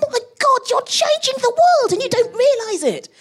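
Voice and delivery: very high, near-tearful amazement; amazed outburst. Retold or imitated. Telling a modest scientist her worth, very high amazed cry. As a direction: squeal it out in wonder. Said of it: "And I'm like".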